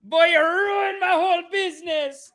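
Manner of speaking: put-on high, slow, loud West Indian accent wail; yelling West Indian coach. Coach yelling at an injured kid, West Indian accent. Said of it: "he's just yelling at the kid".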